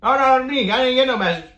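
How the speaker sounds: high, loud mock-whine of refusal; roommate's indignant protest. Retold refusal at the door.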